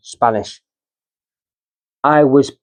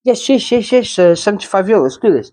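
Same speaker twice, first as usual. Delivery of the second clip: eager Spanish driver; 7 semitones higher than usual. Retold or imitated. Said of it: "He goes".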